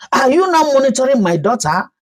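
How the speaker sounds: quick, high, sharp retort; snapped. A narrator's reading. Ifnanya snapping at nosy neighbor, fast high retort. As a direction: snap back fast in offence. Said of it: "Ifnanya snapped".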